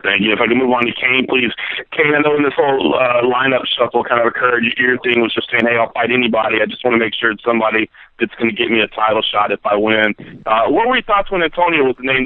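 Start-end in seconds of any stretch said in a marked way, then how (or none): none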